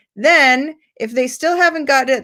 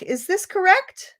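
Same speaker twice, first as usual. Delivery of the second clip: high questioning "Is this correct?"; teacher prompting the student to check; gentle check question; 8 semitones higher, 3 dB quieter than usual. Shown as a demonstration. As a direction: nudge them to doubt their answer. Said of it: "then you can ask".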